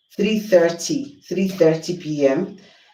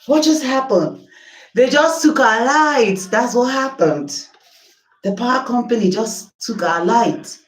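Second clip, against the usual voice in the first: exasperated disbelief; 8 semitones higher, 3 dB louder than usual. Real, not acted. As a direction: stretch out the disbelief, pitched up. Lights cut out during live.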